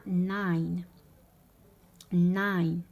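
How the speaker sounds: loud, drawn-out ناين with a gliding to i; ناين like English "nine"; ai diphthong. Shown as a demonstration. Teacher models German "no" for learners, ناين with stretched ai. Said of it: "يتنطق تاني اي".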